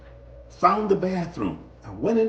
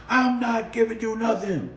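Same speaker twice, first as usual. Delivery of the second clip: higher, gruff driver refusing; bus driver voice; 7 semitones higher than usual. Retold or imitated. Bus driver refusing the stranded rider, stubborn voice. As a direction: play the driver shutting him down.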